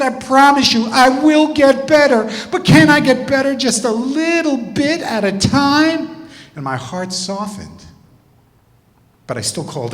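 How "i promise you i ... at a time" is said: high, loud mock pleading; imitating a student desperately promising. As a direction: beg in a drawn-out, high voice. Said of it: "the tears started and the pleading".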